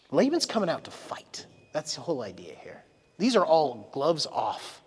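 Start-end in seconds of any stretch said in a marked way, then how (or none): 1.7-2.8 soft, breathy aside to the crowd; whispered aside. Real, not acted. Preacher confiding to the congregation, whispered. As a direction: lean in and whisper it.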